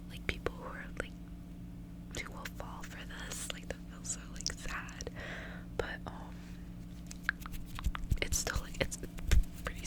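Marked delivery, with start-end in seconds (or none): none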